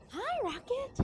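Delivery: high sing-song pet voice; talking to a dog. Real, not acted. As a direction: coo at your dog. Greeting her dog.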